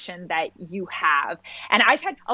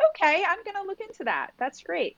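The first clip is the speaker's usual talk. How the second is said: higher, sweetly agreeable mock politeness; polite brush-off; 5 semitones higher, 4 dB quieter than usual. New mother humouring a mother-in-law's advice, higher mock politeness. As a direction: nod along brightly and move on. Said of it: "but you're like".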